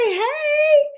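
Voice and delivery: very high squeal of hey; host squealing hello to San Antonio. Real, not acted. Greeting viewer.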